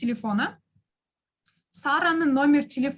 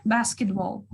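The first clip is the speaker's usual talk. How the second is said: Basketball with the first syllable stressed; 4 semitones lower, slower than usual. Shown as a demonstration. Teacher showing the stressed syllable, Basketball stressed on the first. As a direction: hit the BAS in Basketball. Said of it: "It is on the first one".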